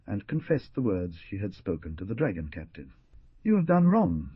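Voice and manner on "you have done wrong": stern. Acted. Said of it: "said the jaguar sternly".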